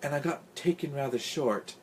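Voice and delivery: tired, weak excuse; weakly. A narrator's reading. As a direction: explain apologetically with little strength. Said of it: "Big Dot said weakly".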